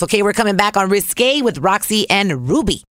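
ordinary talk, nothing marked

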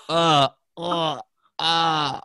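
hammed-up moans of a woman lying hurt; imitated groaning. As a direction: groan like you're winded on the floor. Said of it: "and she's like".

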